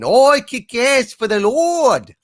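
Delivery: loud-hearted, high, slow Kiwi impression; hammed-up New Zealand drawl; Kiwi accent. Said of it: "that's my Kiwi impression".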